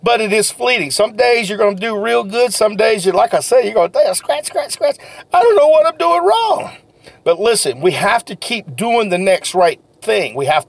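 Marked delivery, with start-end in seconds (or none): 3.9-6.7 higher, fretful mock grumble of a slumping seller; frustrated seller. Salesman imitating a seller on a bad day, higher and fretful. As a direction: act out a frustrated seller who can't close.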